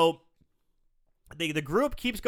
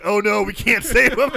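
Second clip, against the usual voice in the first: laughing mock quote; 2 semitones higher, 9 dB louder than usual. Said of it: "They're like".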